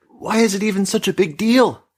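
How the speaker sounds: put-on a teen girl snapping at her mother, voice trembling; trembling snap. A narrator's reading. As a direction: snap it back, voice shaking. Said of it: "Kizzy snapped. Her voice trembled".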